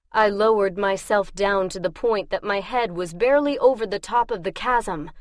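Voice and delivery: usual speaking voice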